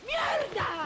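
outburst. Acted. Wife explodes at husband.

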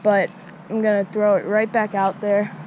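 ordinary talk

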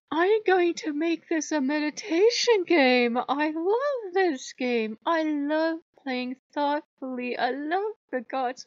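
high, slow, drawn-out gushing; mock serenity. Player pretending to love a meditation game, mock serenity. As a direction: stretch it out in fake bliss. Mocks being a thoughtful player.

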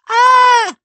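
shrill high scream of fright. Acted. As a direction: scream out in sudden terror. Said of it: "screamed two tiny voices".

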